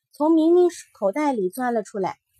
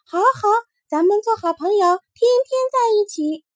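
high, bright little bunny voice, smiling as it speaks; a tiny rabbit happily agreeing to be friends; 8 semitones higher, 4 dB louder than usual. Acted. Little rabbit answering Mingming, high and smiling. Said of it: "笑眯眯地说".

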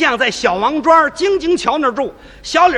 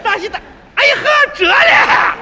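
very high, loud, laughing call; joyful shout; 12 semitones higher, 3 dB louder than usual. Retold or imitated. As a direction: yell out her name in surprise. Shouting on seeing the girl. (高声惊喜地喊)

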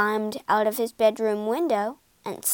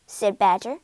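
ordinary delivery, nothing marked

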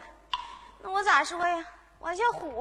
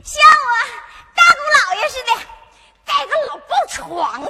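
much louder, higher comic yell; loud blurt; 5 semitones higher, 7 dB louder than usual. Acted. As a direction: shout out the made-up answer. 丫鬟胡编乱喊. (大声喊着胡编)

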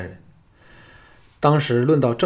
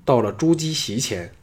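usual speaking voice

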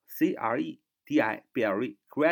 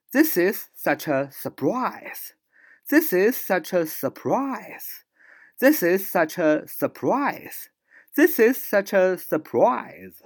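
higher, slow "This is such a surprise"; acted surprise; 7 semitones higher, 5 dB louder, slower than usual. Shown as a demonstration. Teacher performing surprise for the class. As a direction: let the surprise build.

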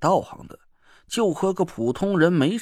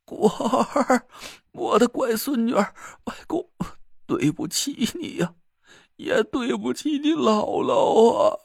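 put-on old man weeping, hoarse voice; hoarse crying; 6 semitones higher than usual. A narrator's reading. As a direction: sob the apology out, slowing. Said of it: "顿时就干哑着嗓子哭了起来".

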